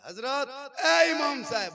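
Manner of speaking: loud, drawn-out call to the imam. Acted. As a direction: shout for the imam from the car, slowly. Father calling out to imam.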